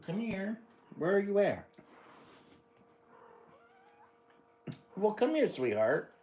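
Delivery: dad calling his little dog over, high coaxing voice; coaxing pet talk. Real, not acted.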